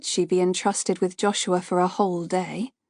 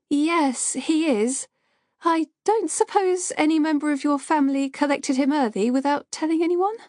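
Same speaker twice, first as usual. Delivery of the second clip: put-on higher, nervous young woman's voice; shaky young woman; 7 semitones higher than usual. A narrator's reading. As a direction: sound like an anxious nursery worker. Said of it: "A shaky young woman's voice".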